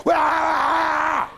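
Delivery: loud, high mock shriek of "Wah!"; wife's yell. Wife ordering husband onto the balcony, loud mock shriek. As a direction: bark it out like a furious wife.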